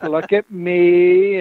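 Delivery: slow, drawn-out mock boast; mock showing off. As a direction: show off lazily, stretching each word. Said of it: "and he's like".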